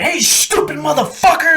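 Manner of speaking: high-pitched abusive holler; imitated sergeant yell. Sergeant starting on a marine, mock angry yell. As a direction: open with a hollered insult. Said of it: "it always started".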